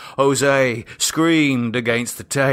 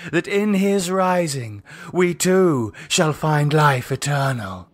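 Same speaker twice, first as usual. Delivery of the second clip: cult leader Lydia; 6 semitones higher than usual. Acted. Cult leader addressing the circle, solemn and raised. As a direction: intone it like a high priestess.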